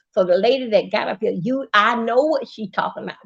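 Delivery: ordinary talk, nothing marked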